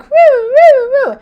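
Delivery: high, loud siren whoops; car alarm imitation. Telling viewers about the alarm, high loud siren imitation. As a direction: mimic the wailing car alarm. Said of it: "It's like".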